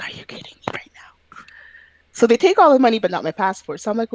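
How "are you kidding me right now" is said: incredulous whisper. Retold or imitated. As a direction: breathe it out in disbelief. Retold disbelief, whispered aside.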